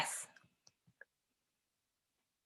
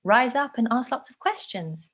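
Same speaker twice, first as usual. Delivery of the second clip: pitched up, questioning lilt; high, rising; 6 semitones higher than usual. Shown as a demonstration. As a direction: demonstrate a high pleading pitch. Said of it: "would you speak highly and rise up".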